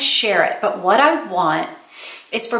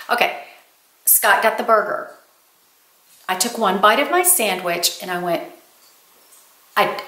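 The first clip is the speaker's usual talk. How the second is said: ordinary talk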